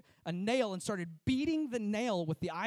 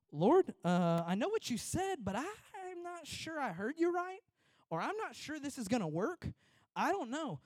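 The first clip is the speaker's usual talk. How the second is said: doubtful prayer; 7 semitones higher than usual. Retold or imitated. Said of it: "I'd have been going".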